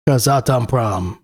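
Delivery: Kazatomprom said in a joking Russian-style accent; mock Russian accent. Shown as a demonstration. Investor reading his stock list, joking Russian accent on Kazatomprom. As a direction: give Kazatomprom a playful Russian accent for authority. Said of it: "I gotta say it like that".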